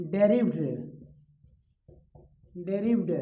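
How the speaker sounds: डेरिव्ड said as de-rived, short e, not डिराइव्ड; pronunciation demo. Shown as a demonstration. Teacher showing students how to say derived. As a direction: model डेरिव्ड twice, clipped and falling. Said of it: "हम पढ़ रहे हैं डेरिव्ड".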